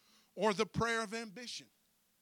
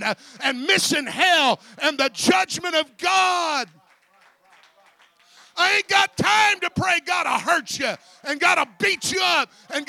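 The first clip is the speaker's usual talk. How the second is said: high, very loud pulpit shout; full-force preaching; 10 semitones higher, 19 dB louder than usual. Real, not acted. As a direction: drive each sentence home at a shout.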